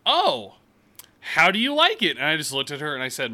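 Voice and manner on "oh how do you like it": much higher, louder, chirpy small talk; high chirpy clerk. Retold or imitated. Clerk making small talk, high and chirpy. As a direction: sing it out like an eager cashier. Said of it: "she's like".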